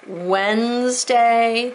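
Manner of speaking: "Wednesday" very slowly as wenz-day; drawn out. Shown as a demonstration. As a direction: stretch both syllables of "Wednesday". Teacher stretches the tricky word.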